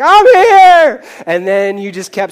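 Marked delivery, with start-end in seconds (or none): 0.0-1.0 much higher and louder, a mock newborn yell; newborn's scream. Newborn announcing its arrival to the room, mock yelling. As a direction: belt it out like a baby arriving. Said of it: "You just screamed out loud and were like".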